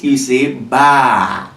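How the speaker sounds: Ba drawn out slow and low; blended Ba. Shown as a demonstration. Teacher showing the class how to blend Ba. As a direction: stretch out the blended Ba slowly. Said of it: "So to blend it".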